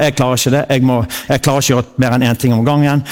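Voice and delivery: ordinary talk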